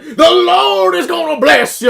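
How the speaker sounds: high, loud, slow preacher voice; mock revival preacher blessing the crowd; preacher impression.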